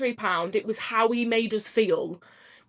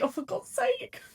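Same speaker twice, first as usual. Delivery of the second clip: exasperated partner; 13 semitones higher, 6 dB quieter than usual. Retold or imitated. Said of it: "And she's like".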